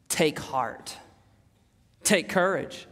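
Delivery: low, soft, measured comfort; hushed, tender words of encouragement; gentle reassurance. Retold or imitated. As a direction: reassure someone suffering, quietly and low. Jesus comforting a paralyzed man.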